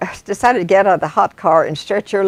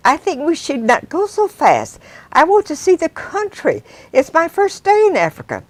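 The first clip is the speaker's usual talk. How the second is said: Janice pleading; 10 semitones higher than usual. Acted. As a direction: politely beg him to ease off. Janice pleads with fast driver.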